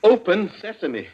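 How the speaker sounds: impatient. Acted. Wimsey losing patience with the voice lock, impatient. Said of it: "confound you".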